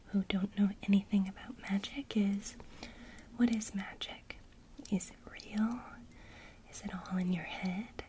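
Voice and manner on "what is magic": usual speaking voice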